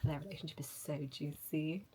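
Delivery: whispered gossip; hushed, delighted whisper. Real, not acted. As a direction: lean in and whisper it like juicy gossip. Gossipy aside about characters.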